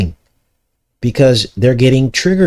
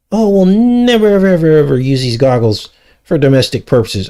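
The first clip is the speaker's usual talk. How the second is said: hammed-up mock reassurance, much higher; mocking promise; 5 semitones higher than usual. Vlogger mocking the army's goggle promise, sarcastic high sing-song.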